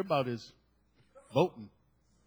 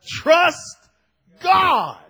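trust God shouted high and loud, each word hit; stress on trust God; 9 semitones higher, 9 dB louder than usual. Shown as a demonstration. Pastor naming the real emphasis of his title, shouted. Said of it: "With the emphasis on".

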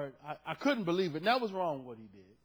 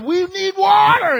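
loud, high, drawn-out mock wail; imitating a clamoring, complaining people; crying out thirstily; 14 semitones higher, 15 dB louder, slower than usual. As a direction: holler it like a demanding crowd. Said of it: "they crying for this and crying for that".

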